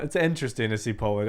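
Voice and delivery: ordinary delivery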